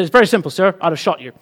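matter-of-fact American policeman; deadpan cop with an American accent. Acted. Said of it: "a bit more of an American accent involved".

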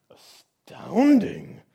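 big, slow, booming monster gasp of wonder; amazed Gruffalo. Acted. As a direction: draw it out like a stunned monster. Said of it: "said the Gruffalo".

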